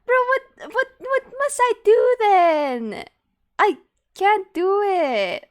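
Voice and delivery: loud, high, drawn-out wail; high-pitched shouted complaint; exasperated wail. Real, not acted. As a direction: cry out in helpless protest, stretching the words. Exasperated at failing the voice lines.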